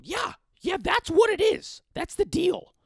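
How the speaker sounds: loud, high cartoon mother arguing; imitating mom's combative squawk; argumentative mom. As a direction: agree as if in a fight. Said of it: "talking like we're arguing".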